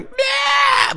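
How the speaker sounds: high mechanical toy meow; shrill little robot meow; Furby meow. Retold or imitated. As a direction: chirp it like an electronic pet toy. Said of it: "they'd stop going".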